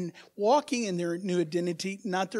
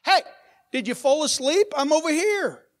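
high, loud mock plea to a sleeping God; desperate call to God; 6 semitones higher, 4 dB louder than usual. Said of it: "and you're going".

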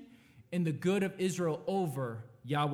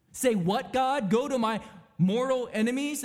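Jonah protesting; 5 semitones higher, 4 dB louder than usual. Acted.

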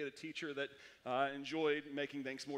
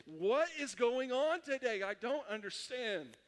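high, loud, drawn-out cry of confusion; exasperated bewilderment; 6 semitones higher, 4 dB louder, slower than usual. Retold or imitated. Student watching others slam papers and leave, exasperated bewilderment. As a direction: play up being utterly lost. Acting out total bewilderment at test.

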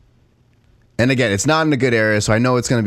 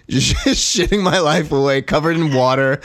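rising higher into laughter; laughing; 7 semitones higher than usual. Real, not acted. Retelling the bathroom standoff to a friend, laughing. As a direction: let the giggles climb as you tell it. Laughing while recalling knife wait.

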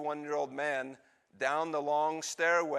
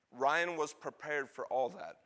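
ordinary talk

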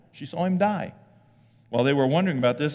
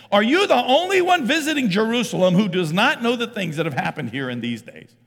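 loud, high, incredulous sarcasm; sarcastic question; 11 semitones higher, 8 dB louder than usual. Acted. Cleopas asking the stranger on the road, sarcastic. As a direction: ask it as if he must be clueless. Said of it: "there's a lot of sarcasm in that question".

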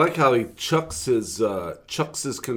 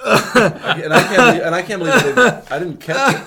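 laughing; 5 semitones higher, 7 dB louder than usual. Real, not acted. Laughing at missed blunder.

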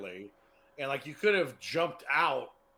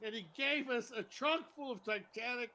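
mildly exasperated, raised pitch; angry guy; 5 semitones higher, 5 dB quieter than usual. Acted. Angry guy resuming his interrupted story, mild exasperation. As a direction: strain to get the story out. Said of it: "you're the angry guy who didn't make the".

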